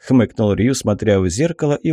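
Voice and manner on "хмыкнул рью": usual speaking voice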